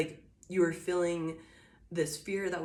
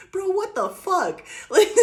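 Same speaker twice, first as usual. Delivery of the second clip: high, loud laughing outburst; laughing exasperation; 14 semitones higher, 11 dB louder, slower than usual. Real, not acted. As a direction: laugh out your disbelief. Laughing disbelief at bad luck.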